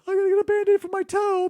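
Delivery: high, put-on mimic voice; Dell. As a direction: pipe up with a lame excuse. Acting out Dell's silly excuse.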